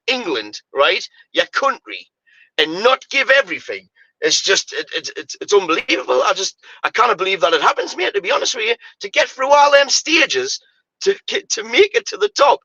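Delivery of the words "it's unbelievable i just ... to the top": exasperated. Real, not acted. Exasperated rant about players.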